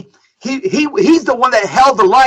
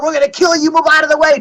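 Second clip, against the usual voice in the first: lightly imitated mob threat, pitched higher; mock threat; 6 semitones higher, faster than usual. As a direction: mimic the mob cussing them out, half-hearted. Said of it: "these guys were cussing them out".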